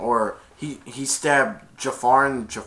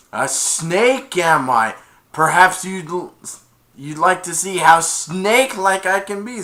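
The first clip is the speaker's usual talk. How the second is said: mock snake menace; 5 semitones higher, 7 dB louder than usual. Jafar menacing reply.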